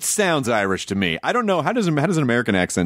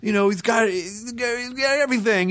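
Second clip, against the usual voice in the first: higher-pitched gushing fan voice; admiring moviegoers; 9 semitones higher than usual. Acted. Host voices people who see him in movies, high and admiring. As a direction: play a fan in awe, pitched up. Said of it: "people see you in movies, like".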